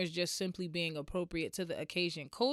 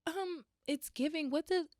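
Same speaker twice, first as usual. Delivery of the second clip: a light mock of a baffled nurse; lightly imitated confused hospital worker; puzzled nurse; 7 semitones higher, 4 dB quieter than usual. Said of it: "She was like".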